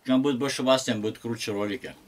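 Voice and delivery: normal talking voice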